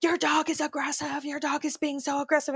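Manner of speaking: imitating a panicked dog owner, high-pitched; freaked-out woman. Alarmed woman at the park accusing a neighbor's dog, freaked out. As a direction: retell her alarm in a high, insistent voice. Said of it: "this woman freaked out".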